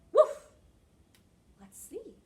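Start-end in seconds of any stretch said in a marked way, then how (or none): 0.1-0.5 short, loud, high woof; dog bark imitation. Shown as a demonstration. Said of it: "practice some animal sounds".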